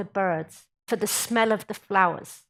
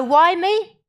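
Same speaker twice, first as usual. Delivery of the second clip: higher, louder mock whine; a complainer's raised why me; 7 semitones higher, 6 dB louder than usual. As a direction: whine it like a victim. Acting the complaint.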